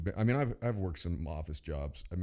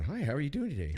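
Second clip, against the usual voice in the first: higher, sing-song bank greeting; cheery teller greeting; 7 semitones higher than usual. Retold or imitated. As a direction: greet each car brightly. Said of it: "It's just everyone".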